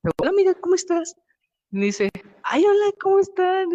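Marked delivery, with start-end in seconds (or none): 0.2-1.2 much higher and louder, sugary put-on voice; mock sweet greeting. Speaker greets the silent girl. 2.4-3.7 put-on much higher and louder girly voice; imitating the girl. The stranger answering the group's greeting, high imitated girly voice.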